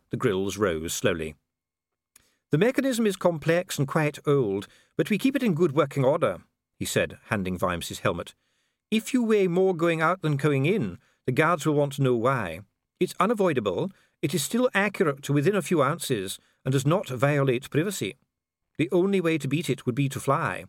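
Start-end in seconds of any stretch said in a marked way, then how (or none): 2.5-6.4 higher-pitched, formal official; precise official Dee. Acted. Official explains the mechanism to visitor, formal. 8.9-20.6 higher-pitched, formal official; precise official Dee. Acted. Official explains the mechanism to visitor, formal.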